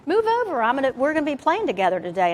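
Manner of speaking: storming in. Retold or imitated. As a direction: barge in and claim the seat. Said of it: "he comes storming up".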